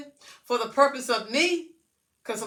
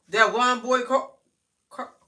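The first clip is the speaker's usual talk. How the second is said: ordinary delivery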